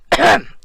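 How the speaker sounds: pointed, loud throat clear. Pretend. Composing oneself after an outburst, pointed throat clearing.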